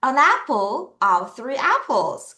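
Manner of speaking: slow, careful model reading; slow read-along. Shown as a demonstration. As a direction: stretch it out so students can follow. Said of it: "같이 읽어요". (천천히 따라 읽기 시범)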